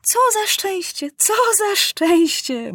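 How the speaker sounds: high-pitched, drawn-out rejoicing; beside herself with joy; overjoyed. A narrator's reading. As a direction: marvel at the good fortune in a high voice. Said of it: "z radości nie wiedziała, co robić".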